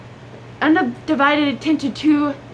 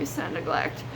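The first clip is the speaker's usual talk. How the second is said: whispered repeat; 9 semitones lower, 11 dB quieter than usual. Real, not acted. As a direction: whisper "It's not neglect." much lower and softer.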